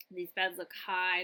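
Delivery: ordinary delivery, nothing marked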